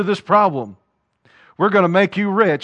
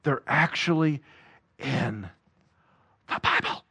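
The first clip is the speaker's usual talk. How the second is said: hushed reveal; 4 semitones lower, 8 dB quieter than usual. Real, not acted. Preacher hushing his congregation for a reveal, whispered. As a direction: drop to a near whisper. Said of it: "get this, guys".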